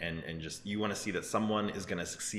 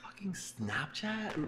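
drawn-out, high, incredulous; can't believe anyone would pay that much; stunned disbelief; 13 semitones higher, 3 dB quieter, much slower than usual. Real, not acted. Reacting to the billion-dollar offer, drawn-out stunned disbelief.

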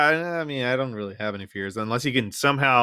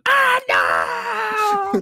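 shrill, loud mock-horror scream of no; horrified no; 4 dB louder than usual. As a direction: scream it as if your own son turned monster. Imagining It as her son.

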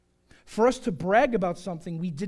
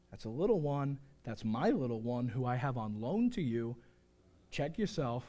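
low, soft warning; quietly firm, pitched well down; parent reining back in; 8 semitones lower, 7 dB quieter than usual. Acted. Parent reminded of God's little one, quiet warning. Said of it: "like that parent who went a little too far".

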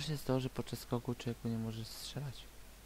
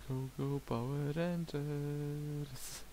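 slow motion speech; 5 semitones higher, much slower than usual. Pretend. Cheering Power Rangers call.

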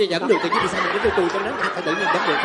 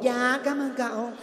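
mock sweet reply; 3 semitones higher, 5 dB quieter, much slower than usual. Servant sweetly answering request.

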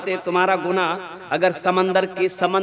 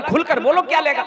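scolding; 7 semitones higher than usual. Acted. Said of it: "डांट कर बोला कि".

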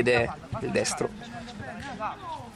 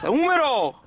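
excited shout; 12 semitones higher, 5 dB louder, slower than usual. Acted. Commentator shouts at trick.

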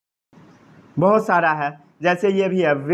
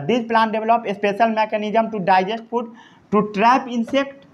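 dictation; much slower than usual. Shown as a demonstration.

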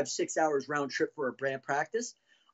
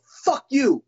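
louder, higher retold outburst; angry retort; 7 semitones higher, 8 dB louder than usual. Musician snaps back at bandmate who blamed him, retold angry retort. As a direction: fire it back as in a heated quarrel. Acted quarrel with late bandmate.

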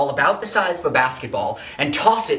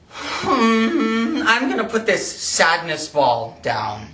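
high whimpering hum easing into speech; whimper, then speak sorrowfully; mock sad whimper; 7 semitones higher, slower than usual. Puts sad ball away, moaning.

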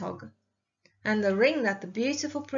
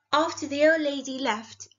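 usual speaking voice

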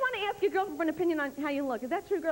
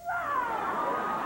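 straining macho guy; 10 semitones higher than usual. Acted. Flexing straining grunt.

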